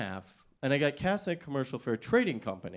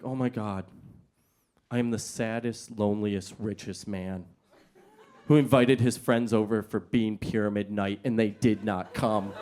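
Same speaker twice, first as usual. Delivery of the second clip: mock self-pity; 7 semitones lower than usual. Actor pretending to be a lonely rich man, mock sad.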